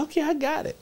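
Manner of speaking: put-on high tiny child voice; little kid voice. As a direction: answer like a small boy. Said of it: "I said".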